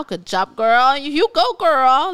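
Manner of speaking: playful encouraging cheer. Acted. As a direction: hype the letter writer up. Cheering the letter writer.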